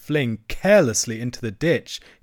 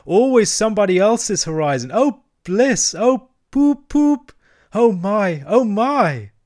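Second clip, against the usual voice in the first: high, loud ecstasy, climbing to a hoot; building rapture; 7 semitones higher, 6 dB louder than usual. Acted. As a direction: get carried away, higher and louder. Entranced Toad raves about motoring.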